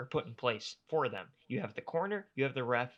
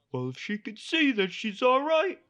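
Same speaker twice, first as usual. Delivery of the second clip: higher, louder put-on doctor voice; mocking the doctor; 9 semitones higher, 7 dB louder than usual. Host mocking the doctor's excuse, high mock doctor voice. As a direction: parody his excuse. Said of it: "his response was".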